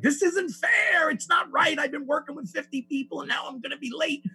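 high, loud whiny shout of outrage; freaked-out complaint. Retold or imitated. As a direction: wail it like a spoiled kid on the roadside. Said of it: "freak out".

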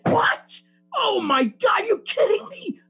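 shocked outrage. Retold or imitated. Hearing Tommy lied too, a high outraged cry. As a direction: squeal in disbelief at the confession.

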